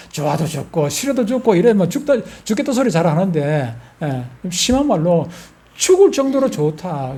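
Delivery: normal talking voice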